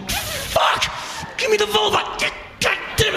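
high-pitched exasperated shouting; hammed-up outburst of frustration; frustrated yelling. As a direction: yell it high, at wit's end. Acting out frustrated pursuit.

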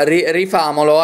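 usual speaking voice

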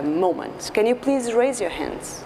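ordinary talk, nothing marked

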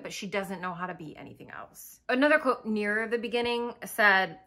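ordinary delivery

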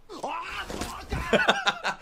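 high breathy laugh fading out; high giggle. Real, not acted. Streamer amused by the ad, high laugh.

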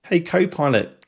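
ordinary delivery

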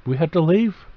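a hint of whining kids; childlike protest. Adam and Eve pleading with God to stay, mildly whiny. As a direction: plead a little, like children asking. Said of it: "protesting, too, like kids do".

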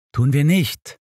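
mildly obstinate; stubborn. A narrator's reading. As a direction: dig your heels in. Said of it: "erwiderte ich eigensinnig".

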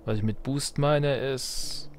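ordinary delivery

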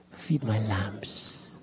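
slow, tender whisper. Acted. Preacher whispering Jesus' words to Peter about the lambs. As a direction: hush it down as if soothing babies.

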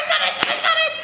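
quick, high pretend alarm; mock panic. Teacher pretending to slam the book shut on the snake. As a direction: slam it shut in playful fright.

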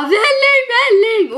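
put-on squealing high and loud, a little kid begging; high, loud kid's plea for välling; excited child. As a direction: shriek it like a thrilled toddler. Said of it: "och jag bara".

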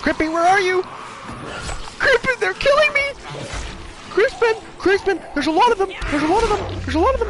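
high shrieking calls for help; terrified screaming. Real, not acted. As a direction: scream his name in panic.

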